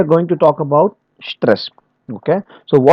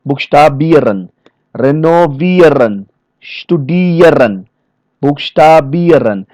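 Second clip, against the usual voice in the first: "Buchstabieren, renovieren, studieren" with a long IE; stress on IEREN; slower than usual. Shown as a demonstration. Trainer drilling verbs ending in ieren, stress on IEREN. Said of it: "the stress is going the ieren part".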